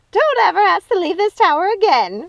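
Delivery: high loud mock of Gothel; hammed-up shouted imitation; mocking scream. A narrator's reading. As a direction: shriek it back in mockery. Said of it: "Ruby screamed".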